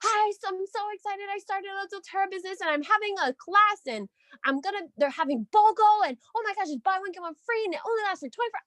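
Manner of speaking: hammed-up breathless enthusiasm ending fast; gushing excited text. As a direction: overflow with excitement, all in one breath. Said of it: "It was novels that was like".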